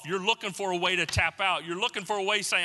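normal talking voice

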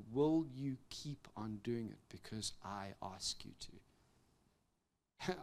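very soft, low and slowing at the end; tender voice of Jesus. Retold or imitated. Jesus speaking to the burnt-out preacher, very soft tender voice. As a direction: speak it gently, like a loving call.

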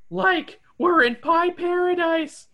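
high, slow dreamy sigh; Shaggy voice. Acted. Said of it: "Shaggy sighs".

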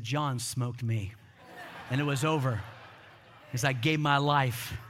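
understated, weighty confession; solemn punchline. Retold or imitated. Punchline turns to solemn testimony.

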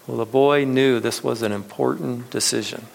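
normal talking voice

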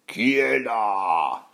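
long, drawn-out troll growl; stretched-out menacing challenge; rumbling troll. Acted. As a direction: growl it very slowly as a hungry troll. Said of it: "gronda le troll affamé".

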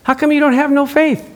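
high, chiding voice of God; preacher voicing God rebuking her; God's voice, chiding. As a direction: scold gently from above. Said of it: "God said".

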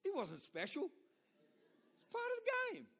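lightly mocking, offhand and dismissive; careless shrug. Preacher mocking his younger self, offhand and dismissive. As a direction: brush it off like a careless kid.

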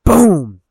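loud, high "boom" sound effect; comic-book smack, voiced big; imitated blow. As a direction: punch out the impact noise.

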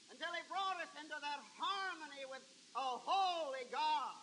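very soft, reverent pulpit voice; quiet, intimate testimony from the pulpit; hushed preaching. Real, not acted. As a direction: bring it right down to draw them in.

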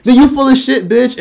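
sudden loud high-pitched call-out; indignant outburst. Real, not acted. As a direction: snap it right at them. Ranting at fake believers.

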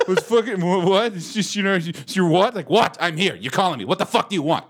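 put-on high, stammering dumb-teen voice; mocking stammering teen. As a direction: play the flustered kid. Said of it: "So he's like".

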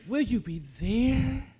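usual speaking voice

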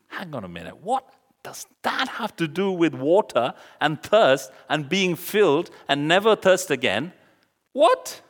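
high-pitched, incredulous questioning; baffled disbelief. Acted. Preacher voicing a baffled reaction to Jesus' odd reply, incredulous. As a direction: build to a loud, squeaked "what?".

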